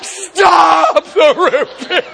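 high, loud, slow laughing protest; a hammed-up cry to stop, stretched out and laughing; laughing shout. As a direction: beg them to stop through laughter. Laughing plea at endless root beer.

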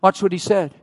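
ordinary delivery

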